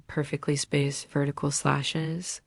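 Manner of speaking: plain reading voice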